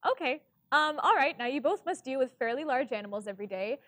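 usual speaking voice

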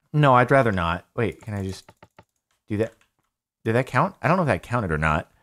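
ordinary delivery

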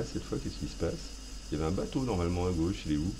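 usual speaking voice

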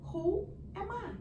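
usual speaking voice

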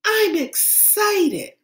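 put-on child echoing. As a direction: repeat it as the kid. Child repeats teacher's model.

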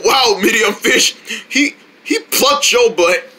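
ordinary talk

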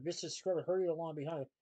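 plain reading voice

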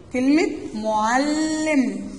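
معلم drawn out slowly, every sound stretched; slow example word. Shown as a demonstration. Teacher to her pupils, slowly drawing out the example. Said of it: "مثال عندنا تاني".

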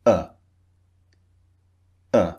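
"uh" as a very short, simple schwa; short neutral vowel. Shown as a demonstration. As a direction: say "uh" with relaxed, unrounded lips. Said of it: "It's a very short, simple sound".

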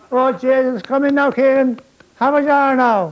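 high, loud Irish brogue; boozy Irish welcome, broad brogue; Irish players offering the captain a drink, loud brogue; Irish accent. Retold or imitated.

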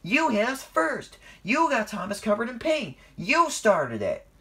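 indignant squabbling shout; heated accusation. A narrator's reading. Percy argues back, accusing.

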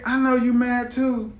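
usual speaking voice

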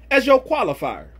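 usual speaking voice

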